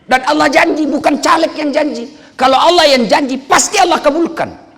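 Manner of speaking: high, loud declaration; loud, high preacher's proclamation; full-force preaching. Acted. Preaching promise to the crowd.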